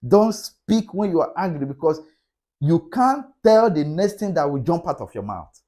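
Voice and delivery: usual speaking voice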